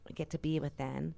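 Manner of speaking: usual speaking voice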